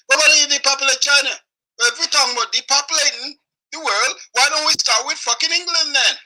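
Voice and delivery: rapid, high-pitched indignation; indignant outburst. Real, not acted. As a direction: fire the question back in outrage. Angry rant about depopulation.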